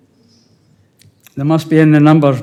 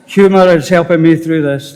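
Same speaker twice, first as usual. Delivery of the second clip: ordinary talk, nothing marked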